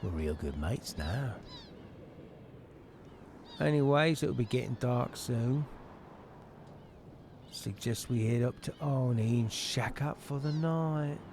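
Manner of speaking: slow, matey character voice; easygoing game mate. Acted. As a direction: play a laid-back pal suggesting plans.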